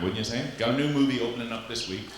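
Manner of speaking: usual speaking voice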